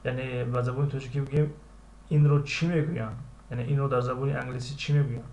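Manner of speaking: ordinary delivery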